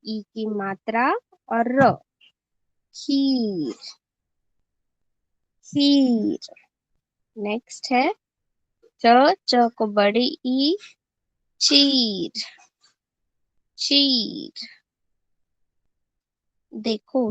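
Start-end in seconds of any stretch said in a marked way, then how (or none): 2.9-6.4 खीर with the ee stretched before र; long drawn-out vowel. Shown as a demonstration. Teacher reads word for students, drawn-out खीर. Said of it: "इसका उच्चारण लंबा किया जाता है". 11.6-14.5 चीर with a long held ee; long drawn-out vowel. Shown as a demonstration. Teacher reads next word for students, drawn-out चीर. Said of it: "इसका उच्चारण लंबा किया जाता है".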